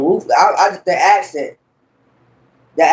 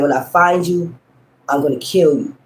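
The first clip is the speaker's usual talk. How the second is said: normal talking voice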